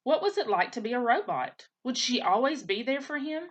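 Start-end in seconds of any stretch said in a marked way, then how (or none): none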